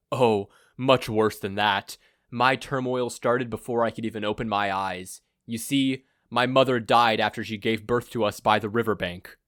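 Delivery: grandiose villain. Acted. Villain gloats to his blindfolded captive, pompous and loud. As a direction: boom out a self-important evil plan.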